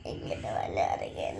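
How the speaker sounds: sobbing the words out, breath catching; crying refusal. Real, not acted. Girl repeating to mother she won't pull her tooth, sobbing. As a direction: wail it through real tears.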